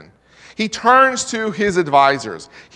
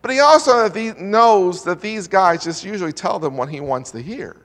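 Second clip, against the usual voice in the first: usual speaking voice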